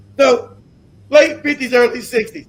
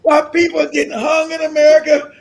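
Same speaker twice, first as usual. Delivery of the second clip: high, loud, incredulous and cracking up; laughing disbelief; 5 semitones higher, 5 dB louder than usual. Real, not acted. Speaker ridiculing the story set amid lynchings, laughing disbelief. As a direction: shout it through laughter.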